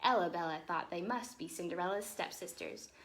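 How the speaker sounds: ordinary delivery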